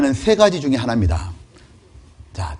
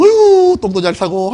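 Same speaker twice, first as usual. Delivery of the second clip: doting mother; 6 semitones higher, 7 dB louder than usual. Retold or imitated. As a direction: sigh of 어휴 shot much higher and louder, then cooing. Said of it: "뭐라 하냐면". (높게 감탄하며 아기를 칭찬하는 엄마)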